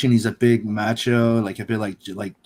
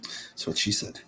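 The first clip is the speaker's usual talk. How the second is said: whisper; 2 semitones lower, 11 dB quieter than usual. Pretend. Joke whispered.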